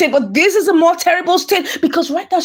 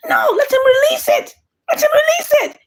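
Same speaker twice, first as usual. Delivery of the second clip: much higher, crying shout; pleading shout; 12 semitones higher than usual. Retold or imitated. He begs her to let the videos go, much higher, crying shout. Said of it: "He said".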